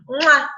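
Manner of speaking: loud smacked "mwah"; blown kiss. Real, not acted. Thanking a supportive listener, affectionate kiss sound. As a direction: send an affectionate kiss noise.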